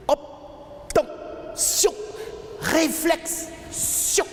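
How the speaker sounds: slow, high isolated bursts; staccato high notes with long gaps; spaced-out syllables. Acted. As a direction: break each word into high, separated syllables. Words declaimed in separated syllables.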